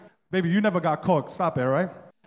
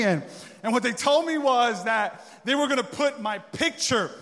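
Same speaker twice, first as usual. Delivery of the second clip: normal talking voice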